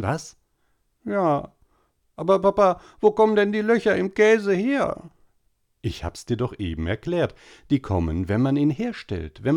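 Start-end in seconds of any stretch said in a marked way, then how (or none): 1.0-5.1 put-on high, whining little boy voice; whiny boy. A narrator's reading. Tobi whining to mama at dinner, high and whiny. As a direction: whine like a small boy pestering mama. Tobi asks papa again.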